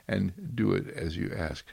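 ordinary delivery

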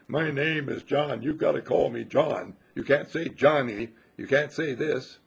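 slow, emphatic complaint about a name; mock fussy correcting; mock indignant insistence. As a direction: insist fussily, hammed up. Mimics people's name hang-ups.

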